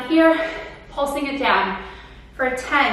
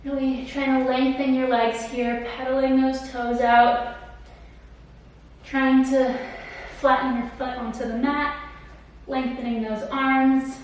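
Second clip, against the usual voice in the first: calm cool-down guidance; 6 dB quieter than usual. Acted. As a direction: ease them into the stretch. Leads the cool-down stretch.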